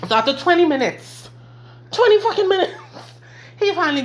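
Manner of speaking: high, loud exasperation at twenty minutes; exasperated storyteller building up. Real, not acted. Exasperated emphasis.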